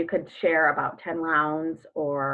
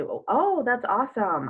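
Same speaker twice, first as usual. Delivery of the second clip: "Oh, oh" jumps way up, then an eager "that's awesome"; excited; 7 semitones higher, 3 dB louder than usual. Pretend. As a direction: gush like you just heard great news. Coach voicing eager reply.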